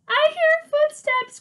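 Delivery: high, loud, drawn-out alarm; frightened cry. A narrator's reading. Timmy to Arthur during the monster tale, crying out in fear. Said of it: "cried Timmy".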